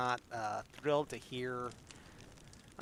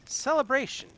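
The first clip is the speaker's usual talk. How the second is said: lifted exclamation; 12 semitones higher, 6 dB louder than usual. Acted. May repeats word, fading.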